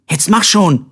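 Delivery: impatient urging. A narrator's reading. Colbert pushing his brother off the train, impatient. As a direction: hurry him along, pitch jumping up.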